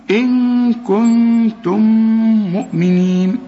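slow Quran recitation, إن كنتم with long nasal hum on each ن; chanted tajweed demo with drawn-out nasal sounds; recited with nasal ghunna. Shown as a demonstration. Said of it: "تلات غنات عندك".

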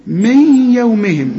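من يومهم slowly, N humming into Y; merging with ghunna. Shown as a demonstration. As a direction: hold a nasal "miyy" in من يومهم. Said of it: "والياء من حروف الإدغام في غنة".

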